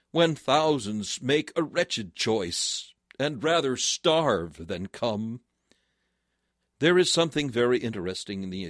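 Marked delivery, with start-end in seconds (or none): 0.1-5.4 slow solemn. Acted. Slow solemn hymn quote.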